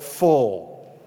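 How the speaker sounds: full drawn out low and weighted; a slow falling full, set apart from the sentence; stress on full. Shown as a demonstration. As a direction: lean hard into the word full. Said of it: "note the word with me, circle it".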